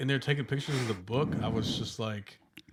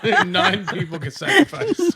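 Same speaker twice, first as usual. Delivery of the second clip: laughing; 17 dB louder, slower than usual. Real, not acted. Laughing while correcting.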